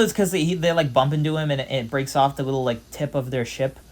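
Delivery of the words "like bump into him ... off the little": normal talking voice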